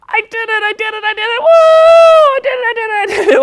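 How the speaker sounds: very high, squealing puppet celebration; very excited. Pretend. Puppet Sammy has finally said his S, very excited. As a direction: burst out high, repeating it in triumph. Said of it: "Very, very excited to say that S".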